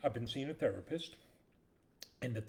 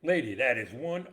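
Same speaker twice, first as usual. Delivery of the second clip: loud, appalled bus driver yell; mock shocked driver; 3 semitones higher, 12 dB louder than usual. Bus driver to the mother, a loud mock shocked outburst. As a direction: blurt it out like a horrified driver.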